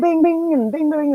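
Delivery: high, loud sing-song bing bing; imitated chat pings. Imitating her mod chat pinging, high pinging sounds. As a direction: mimic notification pings going off one after another.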